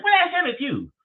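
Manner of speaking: high squeaky voice for the caller; Leonard's voice. Retold or imitated. Retelling the strange caller, high mimicking voice.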